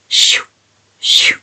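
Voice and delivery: short "shoo" swishes; voiceless swish noise, repeated; imitated page-flipping swish. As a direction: mimic pages being flipped. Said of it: "Do you think she's like".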